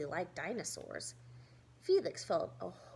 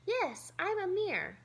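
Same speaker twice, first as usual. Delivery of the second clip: put-on friendly kid voice; 9 semitones higher, 3 dB louder than usual. New kid answers.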